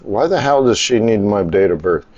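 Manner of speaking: usual speaking voice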